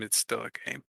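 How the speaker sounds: ordinary talk, nothing marked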